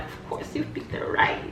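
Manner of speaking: whispered "Of course you picked the ride", soft and low; smug whisper. Retold or imitated. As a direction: hush it like a secret. Repeating her own retold line.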